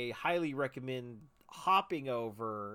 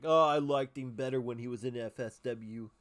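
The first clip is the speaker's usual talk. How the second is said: louder put-on voice of a pompous fan; pompous wrestling fan; 7 dB louder than usual. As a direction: imitate a snobby fan's loud complaint. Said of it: "that's my imitation there".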